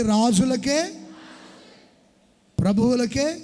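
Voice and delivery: usual speaking voice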